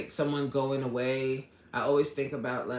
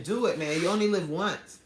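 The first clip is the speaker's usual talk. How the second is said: usual speaking voice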